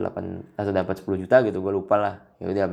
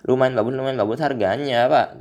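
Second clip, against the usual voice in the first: mock bragging dad; 4 semitones higher, 6 dB louder than usual. Dad bragging about the bike.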